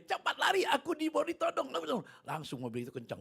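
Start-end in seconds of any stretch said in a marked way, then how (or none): 0.1-1.7 high, loud panicky shout; retold yell. Fleeing preacher yells to bus driver, urgent high shout. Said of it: "Saya teriak sama supirnya".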